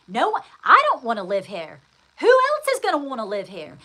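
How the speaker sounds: loud, emphatic protest, rising in pitch; acted-out frustration. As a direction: act out being fed up, loudly. Voices her old negative energy.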